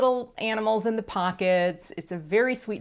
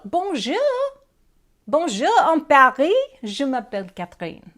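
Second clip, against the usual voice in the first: mock French; 4 semitones higher, 7 dB louder than usual. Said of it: "I am not multilingual".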